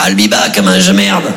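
low, deep robotic Terminator line. Retold or imitated. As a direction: growl it like Terminator. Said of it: "C'était Terminator". (imitation grave de Terminator)

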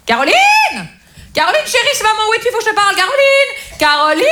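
high-pitched mother calling out for her daughter; frantic mother. Acted. Desperate mother turning up unannounced at her daughter's, calling out. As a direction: call urgently in a high, insistent mother's voice. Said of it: "ma mère était tellement désespérée".

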